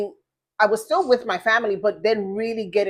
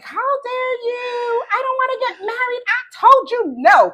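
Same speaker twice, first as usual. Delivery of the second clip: high, indignant mock tantrum at God; retelling how she snapped at the Lord, high and loud by the end; rude indignant outburst; 14 semitones higher, 4 dB louder than usual. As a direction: throw a high-pitched fit of protest. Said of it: "I was being very rude to the Lord".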